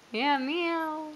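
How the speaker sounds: high, soft little kitty meow; playful meow. Pretend. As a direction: coo it like a tiny cat. Parent voices cat for toddler.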